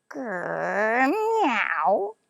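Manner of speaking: growling, rising cat meow; loud vexed grrr meow; angry cat meow. Acted. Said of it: "with an angry".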